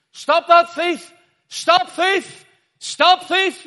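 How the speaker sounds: full-force high-pitched street shout; loud, high open-air cry. Retold or imitated. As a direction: bellow it to halt a whole crowd. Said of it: "I just kept shouting".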